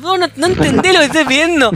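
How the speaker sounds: high, loud, angry yell at the caller; retold shouting match. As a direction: shout her down, exasperated. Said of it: "gritándome con una señora". (grito enojado, agudo y fuerte)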